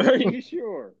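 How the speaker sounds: incredulous echo. Acted. Echoing quote.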